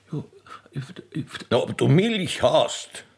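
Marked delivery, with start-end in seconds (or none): none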